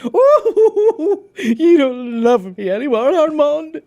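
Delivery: high theatrical whine; Nathan Lane impression. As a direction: wail it dramatically. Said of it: "When Nathan Lane".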